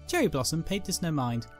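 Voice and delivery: normal talking voice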